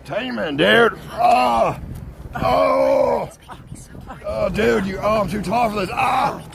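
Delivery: high loud yelps of pain; pained yelling. Real, not acted. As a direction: yell from the pain. Hurting, too tall for bike.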